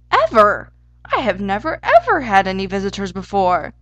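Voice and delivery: loud, emphatic outburst; excited hare. A narrator's reading. Easter hare amazed at having visitors, exclaiming excitedly. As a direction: punch the first words loudly in amazement. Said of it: "at the excited hare".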